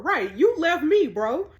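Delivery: indignant outburst. Real, not acted. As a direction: call him out, you left me. Indignant aside about ex.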